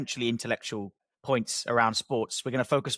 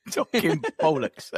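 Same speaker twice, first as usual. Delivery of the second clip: laughing mock Cockney, higher; Cockney accent; 5 semitones higher, 4 dB louder, slower than usual. Host echoing his co-host's title, laughing Cockney accent. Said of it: "say it in a Cockney accent".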